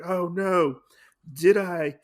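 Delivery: hammed-up "uh-oh" moment; acted alarm. Acting out tripping with the teacup.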